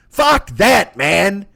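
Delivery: usual speaking voice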